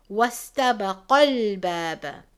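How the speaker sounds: "واستبق الباب" recited with the lam of "الباب" pressed hard; emphasized lam. Shown as a demonstration. Teacher demonstrating, recites "واستبق الباب" stressing the lam. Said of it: "emphasizing the letter lam".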